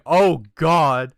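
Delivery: loud, high retold panic; hammed-up burst of dread; mock alarm. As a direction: blurt it out loud, imitating fright. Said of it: "I'm like".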